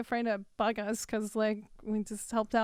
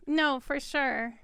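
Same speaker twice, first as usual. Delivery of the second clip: ordinary talk, nothing marked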